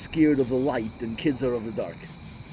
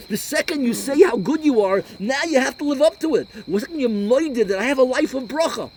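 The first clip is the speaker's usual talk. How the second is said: high, loud, driving sermon voice; rising passion of a pulpit sermon; fiery preaching; 9 semitones higher, 7 dB louder than usual. Acted. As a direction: preach it out to the whole room at full force. Preaching at full force.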